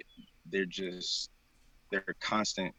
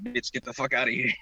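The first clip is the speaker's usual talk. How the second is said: sassy, threatening put-on voice; tough attitude; 4 semitones higher, 4 dB louder than usual. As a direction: growl it like a bouncer. Acting out blocking ghost.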